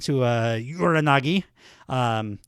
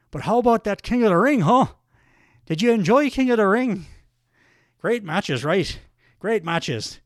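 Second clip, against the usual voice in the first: higher, lilting mock Irish accent; Sheamus; 4 semitones higher than usual. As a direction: put on a hearty Irish wrestler's brogue. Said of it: "some more accent work from me".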